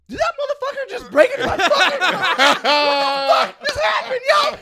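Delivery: very high, loud comic meltdown; retold outraged shouting. Robbed driver on the phone to the cops, high hammed-up outraged shout.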